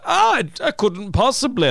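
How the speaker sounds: high, plummy posh character voice; silly posh voice. Shown as a demonstration. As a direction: camp up a silly posh toff. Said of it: "that silly, like, slightly posh sound".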